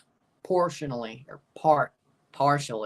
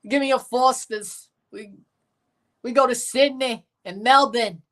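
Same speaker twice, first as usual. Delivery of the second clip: higher-pitched put-on Aussie accent; mock Australian, pitched up; fake Australian accent; 6 semitones higher than usual. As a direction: do a jokey Australian impression. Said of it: "A fake Australian accent".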